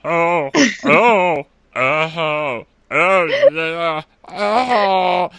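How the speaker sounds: loud, high mock workout grunts; grunting like lifting at the beach. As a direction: ham up sweaty gym grunts. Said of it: "Clay is just grunting completely inappropriately".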